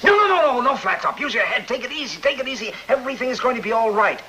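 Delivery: high, rushed, frantic placating; panicked pleading. Acted. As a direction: beg him to calm down before he talks. Gangster boss pleading, panicked.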